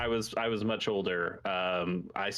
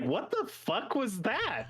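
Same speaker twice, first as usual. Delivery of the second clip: high, slow, appalled parent voice; shocked parent; 12 semitones higher, slower than usual. Retold or imitated. Parents reacting to the cartoon, high and slow in disbelief. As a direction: draw it out like a stunned parent.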